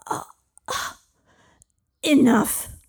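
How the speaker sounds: loud pained dragon roar; agonised shout. Acted. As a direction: cry out in agony. Dragon in agony.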